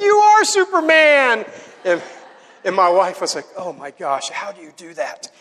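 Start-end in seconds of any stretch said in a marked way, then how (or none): 0.0-1.5 high, slow excited cheer; imitating awestruck kids. Kids to Dad after his cannonball, thrilled. Kids awed by dad's jump. 3.6-5.3 low, hushed; imitating impressed wife. Wife to husband after his cannonball, hushed.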